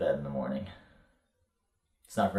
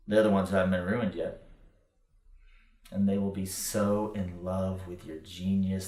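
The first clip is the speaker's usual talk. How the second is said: usual speaking voice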